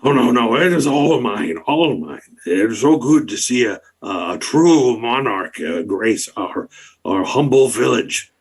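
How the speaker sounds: bow and scrape with delight; fawning duke. Acted. Duke Icrind fawning over the monarch, gushing.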